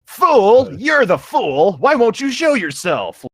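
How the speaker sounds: high, loud furious yell; screamed. Acted. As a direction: scream it back at the hidden enemy. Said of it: "Screamed as he unfastened".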